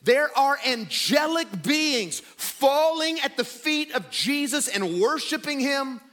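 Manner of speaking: fervent pulpit declaration; impassioned preaching. Real, not acted. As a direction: build in pitch as you declare his majesty. Preaches Christ's exalted status.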